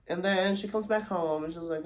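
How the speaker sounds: ordinary talk, nothing marked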